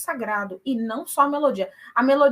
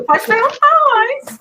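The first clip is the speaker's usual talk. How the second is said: laughing; 12 semitones higher, 11 dB louder than usual. Real, not acted. Laughing after answering.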